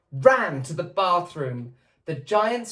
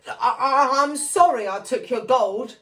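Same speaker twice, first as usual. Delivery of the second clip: nervous stammer; 6 semitones higher than usual. Acted. Jack stammers apology.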